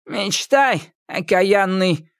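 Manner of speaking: put-on high, sharp old witch retort; indignant witch. A narrator's reading. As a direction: flare up like Baba Yaga. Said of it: "вскинулась Яга". (Яга вскинулась)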